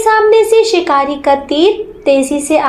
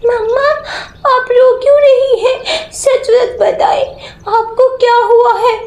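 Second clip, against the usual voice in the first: put-on worried little girl, higher voice; a small child anxiously asking her mother; 9 semitones higher than usual. Daughter asking her weeping mother what happened, small child voice.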